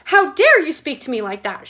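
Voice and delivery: indignant loud shout. A narrator's reading. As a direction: Mrs Salt outraged at Wonka, indignant shout. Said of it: "shouted Missus Salt".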